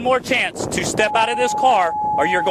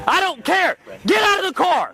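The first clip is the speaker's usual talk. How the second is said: shouting command; 7 semitones higher than usual. Real, not acted. Officer shouts repeated order.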